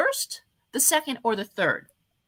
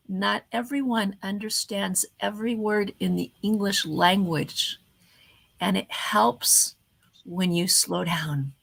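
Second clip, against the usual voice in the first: slowed speech. Shown as a demonstration. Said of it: "vastly decreasing the rate of my speech".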